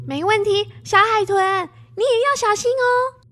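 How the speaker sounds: put-on high, loud young girl's promise; eager little girl. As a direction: answer brightly like a child. Child replying.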